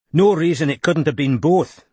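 Glaswegian voice, friendly; gruff Scottish thanks; Scottish man. A narrator's reading. Barclay voiced by narrator.

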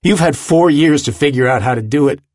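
angry pushing. A narrator's reading. Willem refuses his friend's plea, angry pushing. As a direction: push him with mounting impatience. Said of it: "and still he pushes".

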